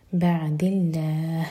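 slow, with a thin, light lam in اللہ. Shown as a demonstration. Teacher modelling the light lam for students, slow. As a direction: keep the lam of اللہ light after بعد. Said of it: "لام جلالہ light".

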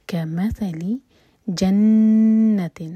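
voiced, very slow tajweed recitation; slow Quran recitation. Shown as a demonstration. Teacher recites the verse to show the rules, slowly. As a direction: draw each syllable out carefully. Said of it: "میم پر زور دیں گے".

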